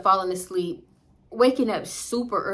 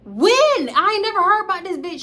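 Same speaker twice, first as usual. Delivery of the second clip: pitched way up and loud, outraged; shrill disbelief at a friend she never heard of; indignant yell; 11 semitones higher, 8 dB louder than usual. Retold or imitated. Confronting her boyfriend about a girl, higher and louder. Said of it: "And I'm like".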